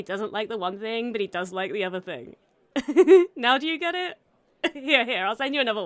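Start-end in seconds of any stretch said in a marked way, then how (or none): 2.7-3.3 loud burst of laughter. Acted. Laughing at her own joke.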